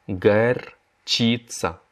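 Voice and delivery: горчица drawn out syllable by syllable; very slow repeat. Shown as a demonstration. Teacher models the word for students, very slowly. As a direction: stretch горчица slowly for learners. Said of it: "La moutarde".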